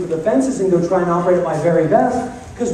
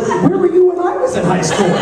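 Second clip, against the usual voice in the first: high, laughing mock outrage; mock shocked; 11 semitones higher than usual. Speaker joking to the audience, high laughing disbelief. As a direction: joke about his own missed youth, laughing. Joking reaction to student's answer.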